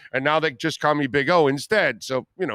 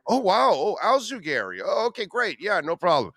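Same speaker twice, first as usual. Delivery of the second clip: higher-pitched mock easy acceptance; relieved listeners' reaction; 4 semitones higher than usual. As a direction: imitate people cheerfully catching on. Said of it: "And everybody".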